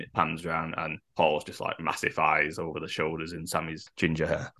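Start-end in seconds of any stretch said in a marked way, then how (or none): none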